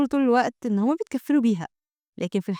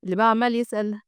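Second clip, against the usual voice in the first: usual speaking voice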